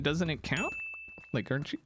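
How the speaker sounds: ordinary talk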